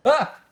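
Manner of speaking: sharp, high, loud yelp of fright; startled yelp. A narrator's reading. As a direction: jump with a sudden scare. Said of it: "Alan startles".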